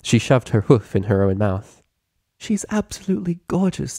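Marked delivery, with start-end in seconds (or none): 2.4-4.0 high, lilting admiration; refined admiring voice. A narrator's reading. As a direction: gush elegantly over the baby. Rarity admiring the baby.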